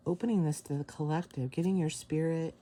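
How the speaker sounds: ordinary talk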